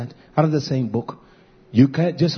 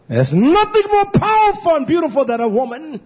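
exalted proclamation; 5 dB louder than usual. Real, not acted. Exalting women to congregation.

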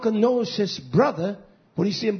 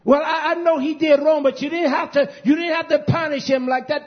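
mocking relative; 8 semitones higher, 6 dB louder than usual. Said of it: "That's how stupid they are".

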